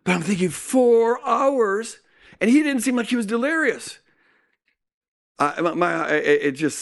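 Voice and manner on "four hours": high, stunned question; shocked disbelief. Retold or imitated. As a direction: hear the number and can't believe it. Shocked inner thought.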